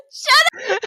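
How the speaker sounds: high teary laugh; laughing while choking. Real, not acted. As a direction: laugh through coughing. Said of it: "And now I'm crying".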